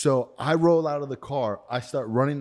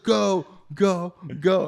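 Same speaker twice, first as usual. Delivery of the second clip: urgent yell; 7 semitones higher, 5 dB louder, much slower than usual. Retold or imitated. Urging friend to drive off.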